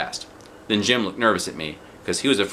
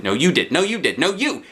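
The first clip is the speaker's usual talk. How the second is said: rising, higher and higher with each no you did; a squabbling kid topping the other; bickering; 9 semitones higher, 4 dB louder than usual. A narrator's reading. Junie B. squabbles with Jim over who started it. Kids' escalating name-blame quarrel.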